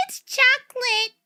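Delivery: high, drawn-out imitation of parents; parents' coaxing voice. Parents passing off blood stew to their child, high coaxing voice. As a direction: mimic parents selling it, high and slow.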